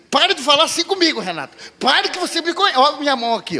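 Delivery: angry wife. Retold or imitated. Wife snapping at husband. (esposa irritada retrucando, aguda e alta)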